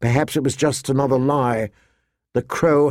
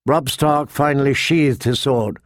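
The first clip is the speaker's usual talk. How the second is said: usual speaking voice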